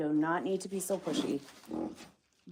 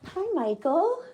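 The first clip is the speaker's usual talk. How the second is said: much higher sing-song hello; pet talk; 12 semitones higher, 4 dB louder than usual. Real, not acted. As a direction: coo the greeting up high to the pet. Greeting the pet.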